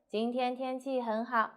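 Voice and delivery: Siri-like even 今天天气很好; no stress. Shown as a demonstration. Teacher imitating Siri, 今天天气很好 with no stress. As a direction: give 今天天气很好 evenly, like a voice assistant. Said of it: "Very like a Siri said".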